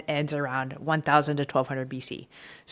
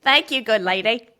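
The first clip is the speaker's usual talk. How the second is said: put-on light Cockney accent, a bit higher; 5 semitones higher, 5 dB louder than usual. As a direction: thank her in a hammy Cockney accent. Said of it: "your best accent is Cockney accent".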